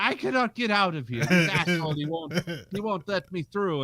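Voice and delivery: high, hammed-up foreign complaint; mad foreigner. Angry trucker complains on his phone, high mock outrage. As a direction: imitate an outraged man in a high voice. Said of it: "he's, like, talking on his phone".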